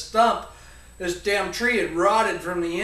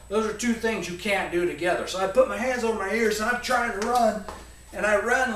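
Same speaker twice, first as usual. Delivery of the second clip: usual speaking voice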